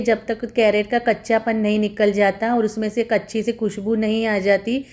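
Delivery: ordinary talk, nothing marked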